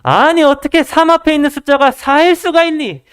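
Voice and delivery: high-pitched mock frustration of a teacher; exasperated, scolding. Frustrated tutor corrects the pupil, angrily exasperated. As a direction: scold in disbelief, drawing out the last words. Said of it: "화를 내면서 말씀을 하시는 것입니다".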